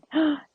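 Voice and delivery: a quick high gasp of surprise; startled intake of breath; sharp surprised gasp. Real, not acted. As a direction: gasp at the sudden animal. Spots a squirrel in game.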